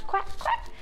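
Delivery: high breathy mock quack; lightly voiced high whispered quacks; duck quack. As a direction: play the duck for the kids. Teacher imitates duck for kids.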